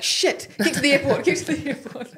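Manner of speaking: high, frantic rush to the airport breaking into a laugh; mock panic. Traveller suddenly late for the flight, mock panic. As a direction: pretend you're about to miss your flight, laughing.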